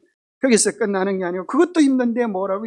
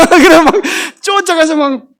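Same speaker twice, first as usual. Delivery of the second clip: laughing; 7 semitones higher, 12 dB louder than usual. Real, not acted. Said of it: "laughing while telling".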